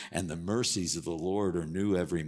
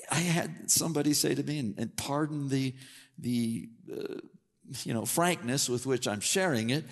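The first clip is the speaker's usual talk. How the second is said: usual speaking voice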